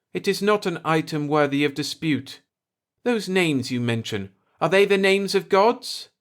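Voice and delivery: high, measured voice of an alien elder; calm old native. A narrator's reading. Old native questions the Earthman about gods, measured. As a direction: shrug it off, then ask.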